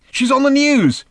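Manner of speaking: high, loud call from another room; shout from next room. A narrator's reading. Arthur calls to Ford from the next room, shouting high. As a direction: yell it through the wall. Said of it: "shouted Arthur from the next room".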